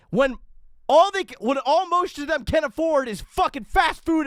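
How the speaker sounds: really losing it at the screen; heated rant. Real, not acted. Reacting to the climate speech, furious ranting shout. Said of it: "I'm getting heated here".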